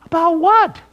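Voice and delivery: high, loud startled question; taken aback. Retold or imitated. Preacher retelling his reply to a surprise meeting request, taken aback. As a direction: blurt it back, loud and high.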